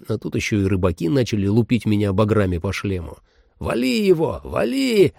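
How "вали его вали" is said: fisherman yelling, much higher. Acted. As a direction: shout it like an angry fisherman. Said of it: "орал один из них".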